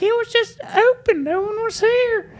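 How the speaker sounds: put-on high innocent girly voice; girlfriend voice. Girlfriend teasing her mom, high innocent voice. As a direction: play it sweet and innocent, pitched high. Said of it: "She's like".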